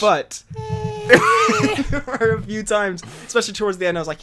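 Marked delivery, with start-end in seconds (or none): 0.5-1.8 loud laugh. Real, not acted. Laughing at friend's joke.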